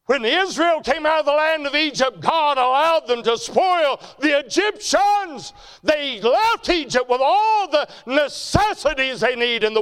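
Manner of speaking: high, loud, slow sermon climax; full-force preaching. Real, not acted. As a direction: thunder the scripture story to the church.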